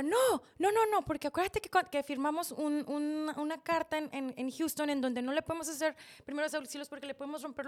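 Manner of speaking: loud high burst, then slightly easing; retold frantic objection. Mother-in-law forbids first aid, loud and frantic. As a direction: cut in urgently to stop someone.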